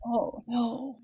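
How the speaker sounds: ordinary talk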